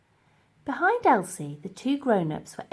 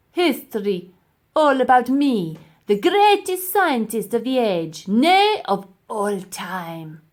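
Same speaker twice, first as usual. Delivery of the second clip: louder, grandiose self-praise building up; pompous professor; 3 semitones higher, 9 dB louder, slower than usual. Acted. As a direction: puff himself up like a great scientist. Professor's boastful speech.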